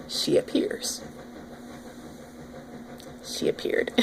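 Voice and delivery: breathy whisper, like a ghost story reveal; whispered dramatic reveal; spooky whisper. Pretend. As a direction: hush it, announcing the monster. Dramatic reveal of alter ego.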